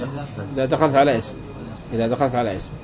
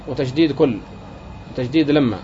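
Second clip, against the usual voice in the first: usual speaking voice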